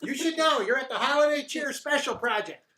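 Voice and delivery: high chirpy gnome; cheery little gnome explaining. Acted. GM gnome voice continues.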